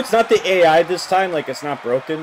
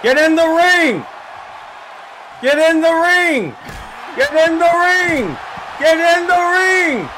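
loud, much higher, slow stretched yells; drawn-out shouting; 5 dB louder, slower than usual. Real, not acted. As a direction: hold each word out in desperate command. Repeated yelling at wrestler.

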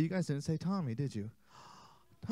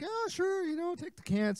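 put-on old man voice; 13 semitones higher than usual. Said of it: "and he's like".

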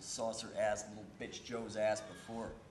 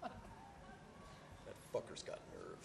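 bitter mutter; 12 dB quieter, much slower than usual. Acted. Grumbles to himself.

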